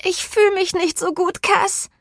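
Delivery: high-pitched, queasy whine; high voice of a friend feeling sick; sick drunken friend. A narrator's reading. Drunk Scarlett complains to best friend, queasy high whine.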